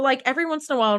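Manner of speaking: ordinary talk, nothing marked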